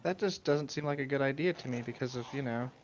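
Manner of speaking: ordinary talk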